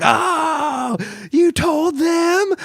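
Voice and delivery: very high, slow mock embarrassed squeal; hammed-up flustered whine; fake blushing. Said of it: "fake blushing".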